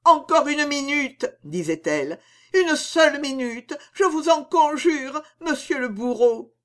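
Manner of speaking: high tearful pleading; tragic heroine sorrow. A narrator's reading. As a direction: beg the executioner through tears. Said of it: "ses yeux noyés de larmes".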